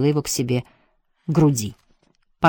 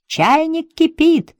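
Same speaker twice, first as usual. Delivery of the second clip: high, drawn-out call; 12 semitones higher, much slower than usual. A narrator's reading. Lottie calls from downstairs about the kettle, calling out. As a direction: call it out slowly in a raised pitch. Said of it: "крикнула Лотти снизу".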